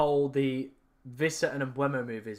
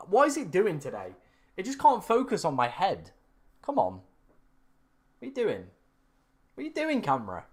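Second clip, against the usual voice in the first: higher-pitched exasperation with falling tunes; exasperated; 5 semitones higher, 3 dB louder than usual. Real, not acted. Streamer scolding the camera that won't focus, exasperated. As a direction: complain in real, high-pitched frustration.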